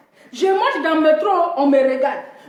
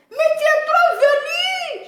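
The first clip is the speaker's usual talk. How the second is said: high, slow, laughing put-on voice; high slow mocking coworker; mock disgusted colleague; 12 semitones higher, slower than usual. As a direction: squeal it out slowly like a scandalized coworker. Colleagues mocking her hairy legs.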